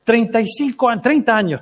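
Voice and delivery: queja aguda, algo exagerada; queja imitada. Retold or imitated. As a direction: imita al que lleva años sufriendo. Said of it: "se empieza a quejar". (lamento actuado)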